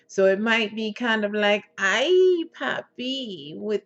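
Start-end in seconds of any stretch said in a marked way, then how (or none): 1.8-3.6 fake Spanish accent. Shown as a demonstration. Coach demonstrating the character Selena, fake Spanish accent. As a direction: put on a sultry Spanish accent. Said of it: "maybe a fake Spanish accent".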